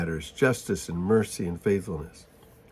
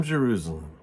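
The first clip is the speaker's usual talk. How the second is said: a lower, softer sorrowing repeat of the name; soft lament; 4 semitones lower, 4 dB quieter than usual. Acted. Jesus grieving over the city, a low, soft sorrowful repeat. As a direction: let the name fall away in sorrow.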